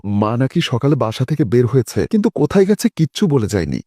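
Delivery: plain reading voice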